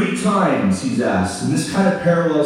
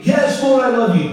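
eager answer; 10 semitones higher, 3 dB louder than usual. Acted. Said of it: "Peter answers".

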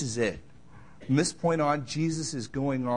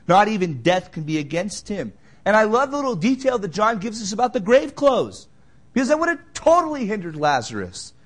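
loud, driving pulpit delivery, much louder than his chat; full-force preaching; 5 semitones higher, 12 dB louder than usual. Acted. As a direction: preach it out to the whole church.

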